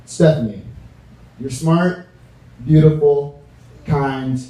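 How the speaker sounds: ordinary talk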